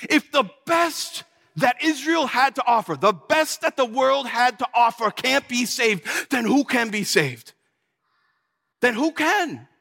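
bewildered protest. Acted. Stunned disciple keeps questioning Jesus.

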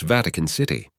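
usual speaking voice

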